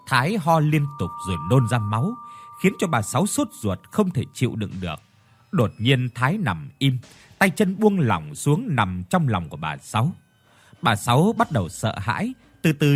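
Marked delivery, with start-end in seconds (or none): none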